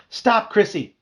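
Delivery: high, loud panicked scream. Acted. Bee screaming at his sister as she swats, panicked. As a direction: scream for her to stop. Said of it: "I screamed".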